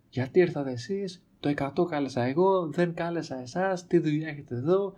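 high-pitched mock rant at the firemen; imitating a raging neighbour. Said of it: "αρχίζει να ορύεται".

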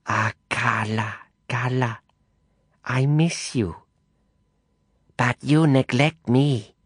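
put-on guilt-trip her like a jealous old patroness; raspy reproachful matriarch. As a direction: Madame Zhou complaining to Carla of neglect, high and rasping. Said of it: "Her voice rasped the word".

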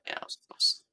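unvoiced, secretive whisper; very soft, whispered sighting; hushed aside. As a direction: whisper as if spotting someone. Pretends to spot her nearby.

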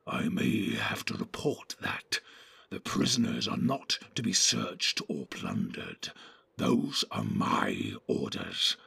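low, whispery orc menace; sneering, hushed, very low creature; soft, evil voice. A narrator's reading. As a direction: whisper a sinister threat. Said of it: "softer than the others but more evil".

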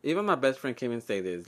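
normal talking voice

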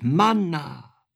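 high, loud, exalted cry; impassioned declamation, high and ringing; fervent calling out. A narrator's reading. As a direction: call it out with rising hope, like praise to heaven. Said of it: "mich erwärmend rief ich".

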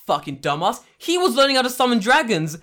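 high, loud mock berating; a tournament boss mocking a contestant, laughing; hammed-up official scolding. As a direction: berate the fighter like a wild judge. Acting official scolding a fighter.

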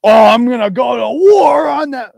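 high, loud mock battle cry; mocking Brett. Streamer mocks rival Brett's online threats, shouting a high mock war cry. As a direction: ham up a tough-guy threat. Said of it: "he's just like".

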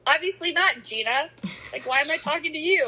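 high-pitched mock exasperation; sarcastic retort. Friend answering Gina who asked if she prayed, sarcastic. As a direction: throw it back as if the question is absurd. Acted retort to pious advice-giver.

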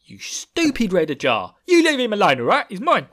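cockney tough guy roaring at the Red Ajah; Michael Caine shouting. Retold or imitated. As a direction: bellow it like a hard man defending his boy. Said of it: "making sure everyone in the Wheel of Time book is suddenly Michael Caine".

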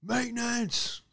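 high, slow, stretched-out call; drawn-out yell. Retold or imitated. Worker announcing himself at the door, a long high drawn yell. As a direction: holler it long through the door. Maintenance calling through door.

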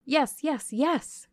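high, eager chorus of yeses; young guests clamouring in delight; excited cry. Acted. The young guests cry out eagerly for the fortune-teller. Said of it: "cried all the juveniles".

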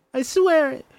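put-on high, tearful child's oath; frightened girl swearing; scared promise. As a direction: squeak out the promise. Mercy pinned, forced to swear.

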